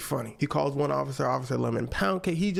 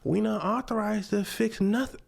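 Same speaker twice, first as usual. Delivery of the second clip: mock smug officer, pitched up; higher-pitched, self-satisfied brush-off; 7 semitones higher than usual. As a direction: imitate a smug official refusing. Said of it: "in a smug tone".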